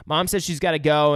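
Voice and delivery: ordinary delivery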